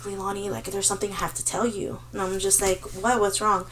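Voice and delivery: low, hushed account of a nightmare; retold grave confession. Roommate confessing nightmares to Leilani, low, soft and grave. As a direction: recount it softly and seriously, as the roommate.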